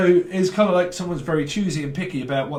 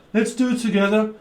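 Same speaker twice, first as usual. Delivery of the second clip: higher-pitched mock Brummie accent; 7 semitones higher, 4 dB louder than usual. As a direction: put on a Birmingham accent. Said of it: "someone's Brummie".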